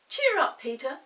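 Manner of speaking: put-on Molly, high and bright child cheer; a little girl coaxing her brother. Molly coaxes cross Peter, high girlish voice. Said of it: "said Molly".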